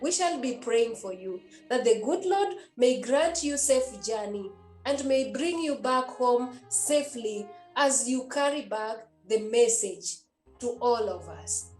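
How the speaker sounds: normal talking voice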